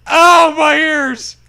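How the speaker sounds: hammed-up yelp of pain; loud slow mock cry of ow; doggy crying out. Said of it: "cries the doggy".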